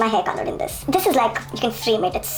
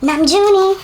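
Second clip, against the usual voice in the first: squealing name with delight; high excited fangirl squeal; awkward joy; 8 semitones higher, 3 dB louder, slower than usual. Real, not acted. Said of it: "I'm just so happy and excited".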